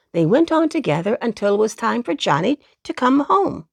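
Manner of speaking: ordinary delivery, nothing marked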